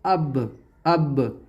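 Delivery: अब with a light jolt releasing the final b; qalqalah bounce. Shown as a demonstration. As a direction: let the b of अब bounce into a tiny echo, low-pitched. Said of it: "हल्के से झटके के साथ पढ़ेंगे". (अब के ब पर हल्का झटका)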